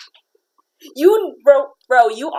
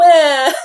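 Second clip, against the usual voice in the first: mock wail; 5 semitones higher, 7 dB louder than usual. Mock cry.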